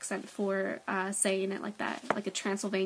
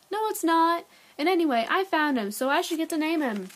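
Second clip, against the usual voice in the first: high, loud sibling protest; indignant kid; 7 semitones higher, 7 dB louder than usual. A narrator's reading. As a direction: argue back hotly, pitched up. Boy acted arguing with brother.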